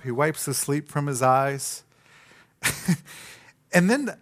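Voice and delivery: usual speaking voice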